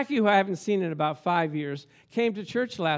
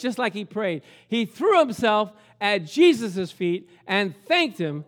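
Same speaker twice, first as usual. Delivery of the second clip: normal talking voice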